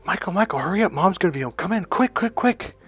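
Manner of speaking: put-on high, excited call to a child; urgent hurrying. Father calling his boy before mom gets home, high and urgent. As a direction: act out a dad urging his son to hurry.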